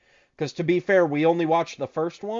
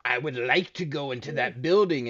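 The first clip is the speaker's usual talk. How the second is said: lower, put-on djinn voice; 3 semitones lower than usual. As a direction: play a djinn making a formal request. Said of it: "the fucking Djinn walks up and he goes".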